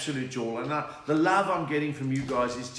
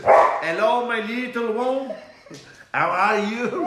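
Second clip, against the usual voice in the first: put-on high, loud French accent; 6 semitones higher, 8 dB louder, slower than usual. Comic obliging a viewer's request, loud high French accent. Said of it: "sound something in a French accent".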